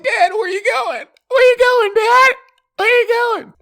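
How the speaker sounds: very high, loud, hammed-up whimpering son; crying son calling after his leaving father, high wail; high whining call. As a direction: call after dad like a wailing kid.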